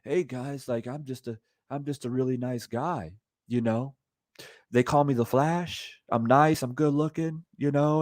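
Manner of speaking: soft, low-key mocking imitation; mock nice guy. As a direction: play a harmless nice guy, hushed and mocking. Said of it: "you tell it in a way as if".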